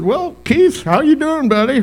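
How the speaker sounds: high, loud, hearty hello; cheerful greeting. Retold or imitated. Pastor hailing Keith on the water, high and loud. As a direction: greet him like an old buddy.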